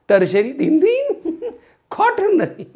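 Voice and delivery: high voice breaking into laughter; amused, laughing through the words. Real, not acted. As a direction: squeak it out through giggles. Laughs while drilling carbon types.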